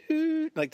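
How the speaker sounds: high beeping "doot" imitation; smoke alarm beep. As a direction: mimic a faint alarm chirp.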